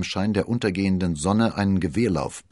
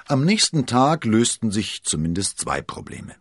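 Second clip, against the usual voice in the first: ordinary delivery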